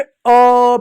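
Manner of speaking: "अ" held long on a level tone; drawn-out vowel. Shown as a demonstration. Teacher showing the vowel that follows, "अ" drawn out. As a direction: stretch "अ" out before moving on. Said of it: "पीछे से लगा आता है".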